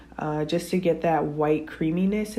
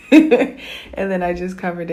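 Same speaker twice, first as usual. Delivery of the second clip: laughing; 10 dB louder than usual. Real, not acted. Laughs at own slip.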